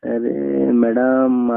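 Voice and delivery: ordinary talk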